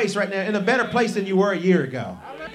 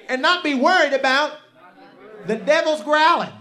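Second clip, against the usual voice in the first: loud, rising preaching cadence; preaching at full force; 5 semitones higher, 6 dB louder than usual. Acted. Preacher rousing the church, full preaching force. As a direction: build it up to the congregation.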